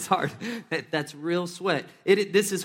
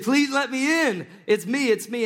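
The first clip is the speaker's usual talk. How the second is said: pleading call; 8 semitones higher, 7 dB louder than usual. Retold or imitated. Peter begging the household to open the door, loudly pleading.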